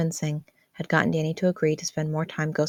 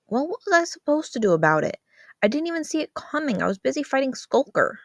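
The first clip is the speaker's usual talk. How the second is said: put-on higher, exasperated teen protest; exasperated teen boy; 9 semitones higher than usual. Brother pushing back at his sister, higher and exasperated. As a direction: throw up your hands, fed-up kid. Voices Danny.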